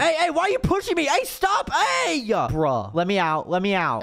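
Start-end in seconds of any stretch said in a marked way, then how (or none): 0.0-2.2 protesting shout. Acted. Shoved toward the cage by the sisters, he yells in protest. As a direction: yell at them to stop pushing.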